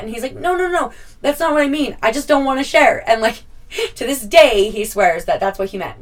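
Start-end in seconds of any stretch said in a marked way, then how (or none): 0.3-3.1 put-on higher, louder protesting teen; protest, caught out; defensive boy. Boy protesting to mom he meant nothing, defensive.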